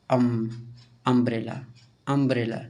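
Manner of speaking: अम्ब्रेला with a short, quick u at the start. Shown as a demonstration. Teacher demonstrating the short u in अम्ब्रेला, quick. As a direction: clip the u in अम्ब्रेला short and don't linger. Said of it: "short में यू का pronounce हो जा रहा है".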